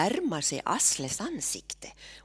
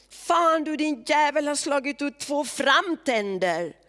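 high and loud, raging cry; a bloodied Olve shouting furiously at Terje; furious outburst; 9 semitones higher, 4 dB louder than usual. Acted. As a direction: rage at the man who broke your teeth. Olve, teeth knocked out, furious.

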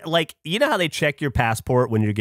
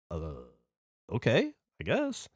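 hesitant; 2 semitones lower, 9 dB quieter, much slower than usual. Retold or imitated. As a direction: agree uneasily, with questioning upticks. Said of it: "And I go".

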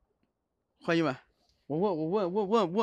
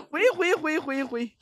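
shouting to retreat; 8 semitones higher, slower than usual. Real, not acted. Streamer dragged back by the ghost, high alarmed shouting. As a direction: yell it out while being dragged back.

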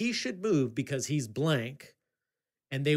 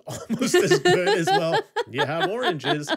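high, loud laughter through the words, a little slower; laughing; 9 dB louder, slower than usual. Real, not acted. Recalling the appraiser's oranges line, laughing hard. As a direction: laugh hard while quoting it.

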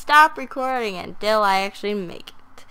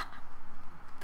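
laugh; 5 dB quieter than usual. Real, not acted. After Guy Fieri joke.

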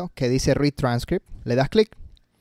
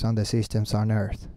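low, slow droning read; lifeless wrong way; 5 semitones lower, 7 dB quieter than usual. Shown as a demonstration. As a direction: read it tonelessly, without imitating anyone. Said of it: "No, así no". (lectura grave y monótona, sin emoción)

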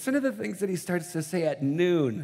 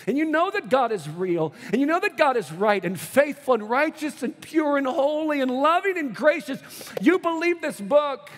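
rising, louder, higher preaching build; preaching at full force; 8 semitones higher, 4 dB louder than usual. Acted. Preacher building up to the congregation, rising and loud. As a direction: preach it harder, climbing with each word.

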